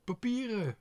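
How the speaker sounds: put-on high, loud girl's cry; excited cry. A narrator's reading. Otje sees the pile of papers, high excited cry. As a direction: cry out the word in delight. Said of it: "riep Otje".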